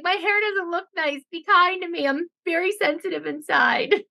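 high, whiny, self-pitying put-on voice; mock whiny Jill. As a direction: play her as fragile and needy. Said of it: "And Jill's like".

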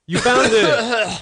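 slow, high triumphant shout; excited shout. Acted. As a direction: yell it like a game show reveal. Massage finale, prize found.

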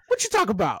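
high, loud sassy put-on; sassy mock voice. Mocking the coworkers, high and loud. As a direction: squeal it out mockingly. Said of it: "continuing mock rant".

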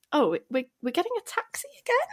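high, strained "this is fine"; forced cheer. Retold or imitated. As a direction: pretend everything's fine. Retold forced cheer in taxi.

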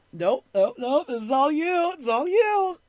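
high, loud, sing-out imitation of passing the buck; mock insistent deflection. Boyfriend leaves all the talking to girlfriend, mock insistent deflection. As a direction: hand it off in a rising mock voice. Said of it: "And he's like".

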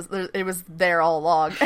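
ordinary delivery

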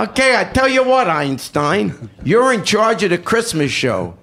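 loud, high barking sergeant; drill sergeant voice. Retold or imitated. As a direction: order it like a smug drill sergeant. Drill sergeant giving an order.

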